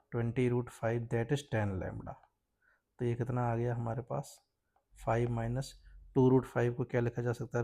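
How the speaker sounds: ordinary talk, nothing marked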